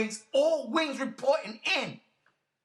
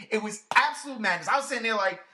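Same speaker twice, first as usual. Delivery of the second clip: ordinary delivery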